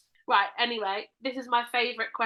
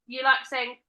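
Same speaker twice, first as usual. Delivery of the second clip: usual speaking voice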